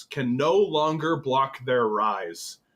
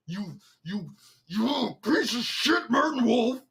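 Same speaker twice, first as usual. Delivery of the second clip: higher, playful fake fury; mock outrage; 6 semitones higher than usual. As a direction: pretend to be furious at a rival. Mock-cursing the article's author.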